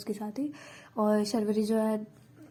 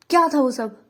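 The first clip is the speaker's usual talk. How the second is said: loud, furious outburst; angry shout; 2 semitones higher, 10 dB louder than usual. Acted. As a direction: bark it out at her. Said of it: "बरस के चिल्लाने लग जाते हैं".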